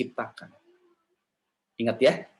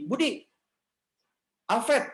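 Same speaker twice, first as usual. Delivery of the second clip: high affectionate calling of names; calling a child; 10 semitones higher, slower than usual. Acted. Parent greeting child after bad grades, high tender call. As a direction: call each name up high.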